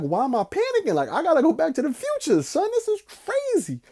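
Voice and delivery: loud, high freaking out, squeaking at the end; panicking. Retold or imitated. Tripping on shrooms during the movie, loud high panic. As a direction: panic out loud, voice cracking upward. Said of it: "I'm like".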